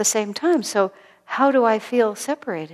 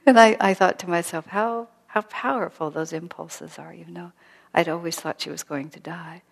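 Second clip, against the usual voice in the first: ordinary talk, nothing marked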